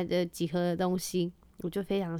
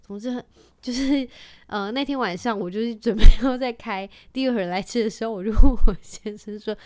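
normal talking voice

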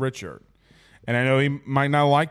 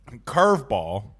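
usual speaking voice

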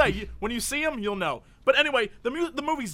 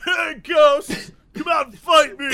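higher, louder mock yell; mock challenge shout; 7 semitones higher, 7 dB louder, slower than usual. Gretel taunting the ghost to fight, mock shout. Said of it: "Gretel's like".

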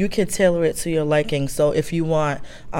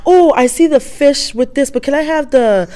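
picky customer; 7 semitones higher, 5 dB louder than usual. Retold or imitated. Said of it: "the customer that goes to the restaurant and says".

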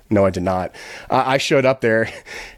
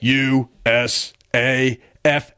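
slow spelling out; much slower than usual. Retold or imitated. Sergeant slowly reading letters.

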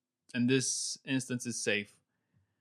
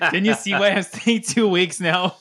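laughing; 8 semitones higher, 12 dB louder than usual. Real, not acted. Excited boast about surfing spot.

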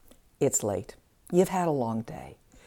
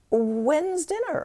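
offhand demand; 11 semitones higher, 4 dB louder, much slower than usual. Acted. Partner passes through asking.

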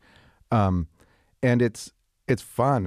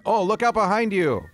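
sudden high warning call; alarm shout; 12 semitones higher, 3 dB louder than usual. Pretend. Joking warning to host.

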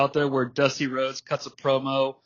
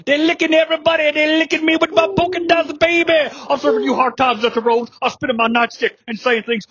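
high, loud, hammy promo voice; wrestling promo; 11 semitones higher, 4 dB louder than usual. Pretend.

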